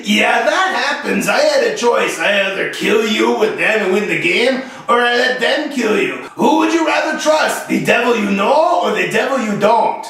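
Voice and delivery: imitated Quebec French teammate accent; mock French Canadian accent. French Canadian gamer justifies killing his teammate, mock accent. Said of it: "he was French, he was from Quebec".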